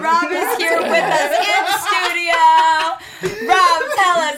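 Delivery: host teasing co-host as Rob, laughing loudly; mock interview laughing. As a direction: tease him as Rob through laughter.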